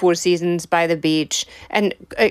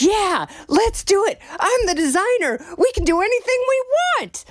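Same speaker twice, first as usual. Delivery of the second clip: high-pitched, gung-ho mock exclamation; excited designer; 11 semitones higher than usual. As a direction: imitate an excited designer, voice soaring to the end. Acting out designer's gung-ho reply.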